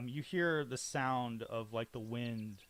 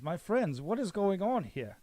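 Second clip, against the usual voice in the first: higher, louder alarmed question; startled to find his friends held; loud worried noble asking; concerned surprise; 7 semitones higher, 8 dB louder than usual. Acted.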